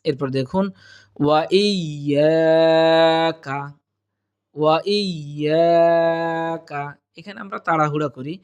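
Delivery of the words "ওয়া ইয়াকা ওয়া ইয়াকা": "ওয়া ইয়াকা" very slow, long "yaa", short "ka"; correct tajweed. Shown as a demonstration. Teacher modelling the phrase, careful tajweed. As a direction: lean on "iyy" in "ওয়া ইয়াকা". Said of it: "এক আলিফ পরিমাণ টানবো".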